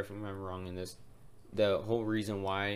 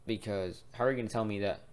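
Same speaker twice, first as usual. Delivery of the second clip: normal talking voice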